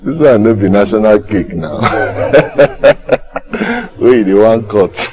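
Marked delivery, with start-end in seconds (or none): 1.9-3.9 long, high-pitched chuckling; high derisive giggle; mocking laugh. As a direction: keep chuckling at his nerve. Mocking demand for cake.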